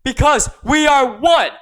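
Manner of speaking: loud, rousing rally cry; fiery revolutionary speech. Acted. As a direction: stir up the crowd to revolt.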